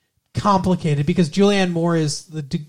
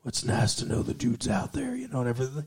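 Sam Elliott voice; 5 semitones lower, 9 dB quieter, faster than usual. Retold or imitated. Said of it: "Sam Elliott line quoted".

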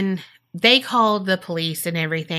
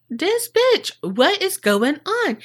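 exasperated boss; 10 semitones higher, slower than usual. Retold or imitated. Delilah exasperated in story.

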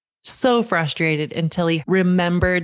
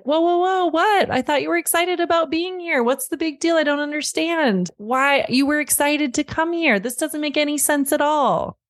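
imitating a baffled dad, pitched way up; mock incredulous, arguing the kid out of his feelings; 9 semitones higher than usual. Dad talks son out of meltdown in parking lot, imitated in a high, incredulous voice. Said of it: "were the types of things he would normally launch into".

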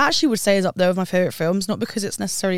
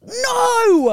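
high, loud shocked "No!"; retold shocked cry; 7 dB louder than usual. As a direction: cry out in disbelief. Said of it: "I remember being like".

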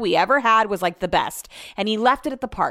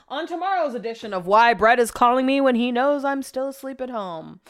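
playful segment-title announcement, starting high; mock announcer; 5 semitones higher than usual. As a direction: announce it like a show teaser, letting it fade.

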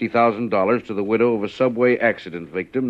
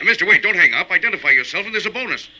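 higher, louder, pleading fast; urgent plea; 10 semitones higher, 4 dB louder, faster than usual. Acted. Agent begging the anonymous caller not to hang up, high and loud. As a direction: call out before he hangs up.

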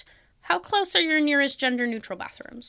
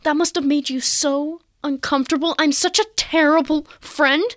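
over-apologetic friend; 5 semitones higher, 6 dB louder, slower than usual. Retold or imitated. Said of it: "'Cause going".

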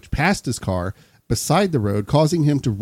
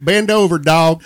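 mock command; 5 semitones higher, 6 dB louder than usual. Joking command to the dog.

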